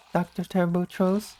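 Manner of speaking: ordinary delivery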